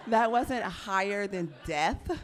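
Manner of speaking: loud, slow, laughing through the words. Real, not acted. Laughing about losing her breast, loud laughing delivery. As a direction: laugh it out as you say it.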